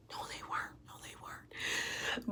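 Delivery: barely audible, voiceless repeats; whispered denial. Retold or imitated. Woman contradicts fiancé about broken headphones, whispered. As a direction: whisper it, slowing on the last one.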